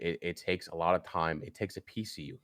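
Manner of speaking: ordinary talk, nothing marked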